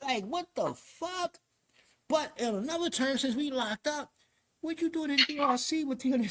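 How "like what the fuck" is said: high, slow, baffled exclamation; retold incredulity. Inmate baffled by a stranger's huge case, drawn-out disbelief. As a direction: drag the words out high in disbelief.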